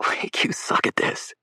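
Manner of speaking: slow whispered tease; teasing whisper. A narrator's reading. Will mocking Lake's acting, hushed teasing whisper. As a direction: hush it so the boys can't hear.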